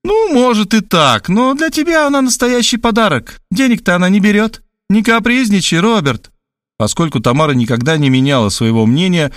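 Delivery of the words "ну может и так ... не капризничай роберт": higher, pushy matchmaker; Tamara's voice. A narrator's reading. Tamara on the phone pushing the match, insistently. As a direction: sell the woman to him.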